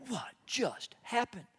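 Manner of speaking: slow, hushed disbelief; stunned parent. Acted. Parent reacting to the prodigal's sudden return, stunned. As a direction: softly space out each word, astonished.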